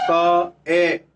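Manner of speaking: "क, ए" broken into separate sounds; sounding out syllable. Shown as a demonstration. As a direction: sound out "क" then "ए", each apart. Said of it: "बाबल की हम sound करें". ("क, ए" को अलग-अलग ध्वनि में बोलकर)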